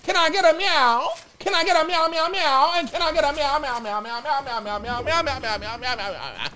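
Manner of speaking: high playful meow chant; cat meowing. Pretend. Chanting meows at viewer.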